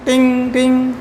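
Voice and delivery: light bell-like "टिंग, टिंग" imitating a fridge; fridge ting sound. Shown as a demonstration. Teacher to class, mimicking the fridge's chime sound. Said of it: "आवाज़ आती है एकदम tinning".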